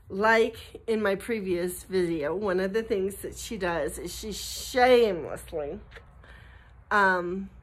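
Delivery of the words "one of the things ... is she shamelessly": usual speaking voice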